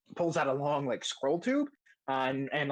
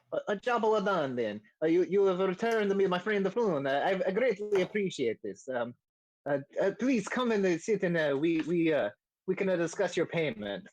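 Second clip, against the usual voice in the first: higher, hesitant mock-Italian accent; stammering, grateful Italian-accented character; Volo, bad Italian accent; 4 semitones higher than usual. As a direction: thank them warmly in a comic Italian accent. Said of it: "the bad Italian accent I was doing for Volo".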